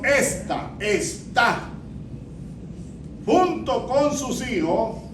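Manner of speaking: slow down and mark each accent of "Esta está"; careful stress reading. Shown as a demonstration. Pastor demonstrating the correct reading: "Esta está" very slow and stressed. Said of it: "hay una gran diferencia entre".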